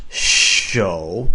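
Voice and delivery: "shou" with a loud, hard sh; hammered sh. Shown as a demonstration. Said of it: "really hammer out that sh".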